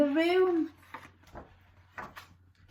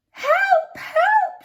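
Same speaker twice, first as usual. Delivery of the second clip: very high, loud cry for help; shrieking old lady; 5 dB louder than usual. Acted. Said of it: "shrieked the old lady".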